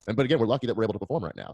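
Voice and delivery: audio suddenly rushing fast, glitchy; sped-up internet lag catching up; speed ramp. Real, not acted. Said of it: "and sped up".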